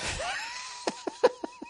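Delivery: shrieking burst of laughter; real high cackle at his own joke. Real, not acted.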